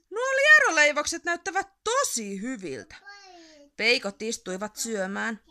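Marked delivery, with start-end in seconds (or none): 0.1-3.0 higher, eager admiring tone; a hint of delighted troll. A narrator's reading. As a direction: eye the pastries hungrily. Troll delighted by worm pastries. (ihastunut peikko)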